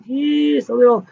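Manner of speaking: coy sing-song understatement; mock-innocent high echo. Real, not acted. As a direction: say it like butter wouldn't melt. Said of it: "you know what I mean".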